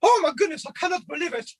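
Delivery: very high, squeaky excitable character voice; high puppet voice, bubbling over; Otis. Shown as a demonstration. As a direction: squeal it with cartoonish glee, far above your natural register. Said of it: "his voice was like this".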